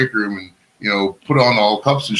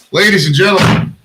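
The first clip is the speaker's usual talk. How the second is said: announcer voice; 5 semitones higher, slower than usual. Pretend. Introducing arriving friend like a fighter.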